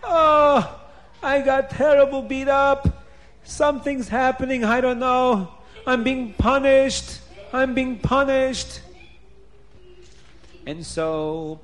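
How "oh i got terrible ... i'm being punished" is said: high, loud, hammed-up wailing; screaming. A narrator's reading. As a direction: cry out in anguish at being beaten. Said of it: "And Paroi is screaming".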